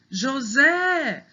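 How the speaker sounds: JOSÉ with a high, held final SÉ; calling the word. Shown as a demonstration. Teacher showing the accented syllable, calling joSÉÉ. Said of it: "o Zé tá acen".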